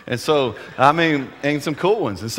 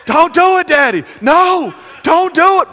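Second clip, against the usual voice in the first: put-on much higher, loud panicked child's shout; panicked kids; 13 semitones higher, 8 dB louder than usual. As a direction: scream at Daddy to stop, terrified. Kids panicking at the mud pit.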